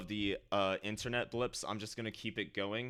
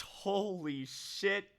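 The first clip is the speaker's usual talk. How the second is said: much higher, slow, awed; stunned disbelief; 9 semitones higher, much slower than usual. Real, not acted. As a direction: let the disbelief stretch it out. Second big donation.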